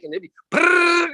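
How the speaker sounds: high imitated blender whirr; blender noise. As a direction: buzz like a blender.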